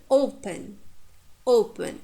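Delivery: "open" said as O-pen, falling away after the stress; stress on first syllable. Shown as a demonstration. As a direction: hit the O of "open". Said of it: "we stress the first syllable".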